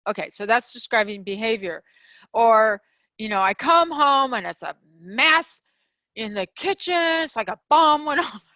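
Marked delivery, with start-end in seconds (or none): 3.4-8.4 louder, exasperated blaming with a laugh at the end; exasperated complaint. Shown as a demonstration. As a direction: vent about the mess and pin it on them.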